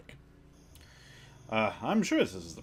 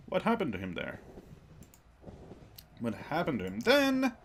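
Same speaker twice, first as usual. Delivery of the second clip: normal talking voice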